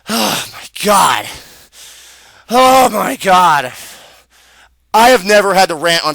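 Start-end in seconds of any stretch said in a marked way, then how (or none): 0.1-3.7 slow, drawn-out exasperation; long exasperated groan of the words; exasperated sighing. Real, not acted. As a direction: sigh it out slowly, at the end of your patience. Exasperated pause in his rant.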